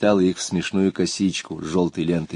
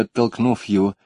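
ordinary delivery

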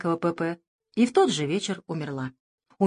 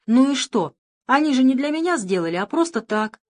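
defiant; 5 semitones higher, 4 dB louder than usual. Acted. Boy dismissive and loud.

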